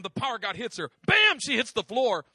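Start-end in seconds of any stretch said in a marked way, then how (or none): 1.1-1.4 high, loud bam; explosive bam; punchy bam. Retold or imitated. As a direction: slam the word out. Power of God hits.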